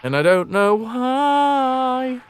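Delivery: slow, high wondering take; slow, drawn-out wonder. Real, not acted. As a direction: let each word hang, puzzled. Repeated line, first drawn out.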